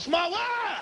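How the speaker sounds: put-on a kid hollering on the ride; kids shouting. Bebe kids yelling on the ride, high shout. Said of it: "talking about".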